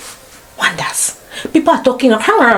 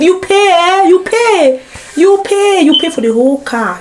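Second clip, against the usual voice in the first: indignant exclamation; 9 semitones higher than usual. Real, not acted. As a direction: hammer home the outrage. Indignant about Cameroon taxi fares.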